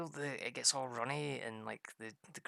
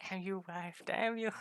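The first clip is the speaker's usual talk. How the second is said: higher, hammed-up curse at the wife; mock outrage; 8 semitones higher than usual. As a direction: shake a fist in jest. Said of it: "It's like".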